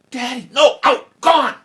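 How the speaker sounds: high, loud final cry; furious shouting. Acted. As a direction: drive her away in anger. King and daughter in quarrel.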